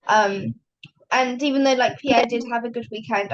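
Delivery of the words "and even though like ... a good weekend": ordinary talk, nothing marked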